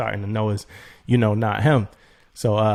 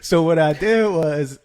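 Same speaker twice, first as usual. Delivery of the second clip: high laugh then a slower start; 4 semitones higher, 4 dB louder, slower than usual. Real, not acted. Laughing with a friend about getting scammed.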